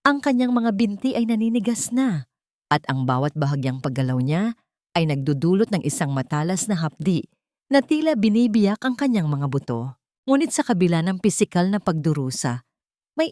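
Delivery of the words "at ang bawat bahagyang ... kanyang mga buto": ordinary delivery, nothing marked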